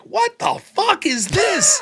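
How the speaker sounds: high, drawn-out incredulous shout; acted disbelief. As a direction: cry out in total bafflement. Acted bewilderment at fast-talking opponents.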